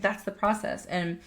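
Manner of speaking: ordinary delivery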